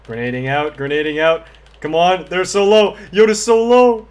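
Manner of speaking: building from call-outs to a high frantic yell; excited shouting. Real, not acted.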